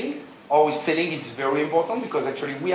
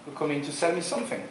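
ordinary talk, nothing marked

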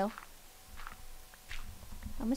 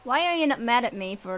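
ordinary delivery

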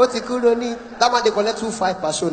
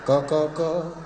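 husband's part sung much lower and softer; low note; 7 semitones lower, 8 dB quieter than usual. Retold or imitated. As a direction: drop to a low hum.

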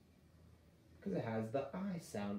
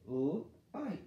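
sounding out; 5 semitones higher than usual. Shown as a demonstration. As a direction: sound it out one more time. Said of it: "are gonna make the I sound".

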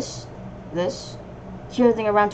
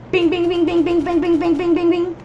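high, chanted string of bings; rapid-fire sound imitation; 7 semitones higher, 4 dB louder than usual. Gamer voicing the machine-gun attack, high repeated bings.

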